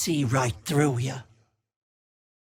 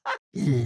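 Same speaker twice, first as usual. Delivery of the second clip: cackle. Acted. Hag cackles.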